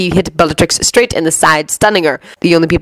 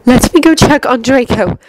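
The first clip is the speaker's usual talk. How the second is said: higher, tense request through clenched jaw; 7 semitones higher than usual. A narrator's reading. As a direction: hold back your worry, jaw tight. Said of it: "said with clenched jaw".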